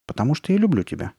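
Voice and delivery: ordinary delivery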